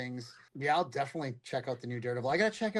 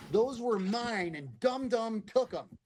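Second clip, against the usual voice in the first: mock crying; 9 semitones higher, slower than usual. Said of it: "he's kind of crying".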